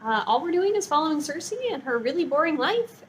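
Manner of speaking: ordinary talk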